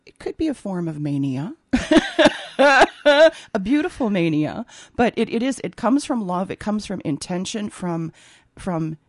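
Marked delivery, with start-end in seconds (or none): 1.7-4.7 laughing. Real, not acted. Laughing about stitching mania.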